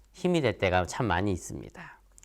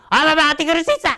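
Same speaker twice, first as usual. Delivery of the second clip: put-on much higher, louder, fast childish whine; whiny outburst; 8 dB louder, much faster than usual. Child rudely protesting to dad, high fast whine. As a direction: squeal it like a bratty kid.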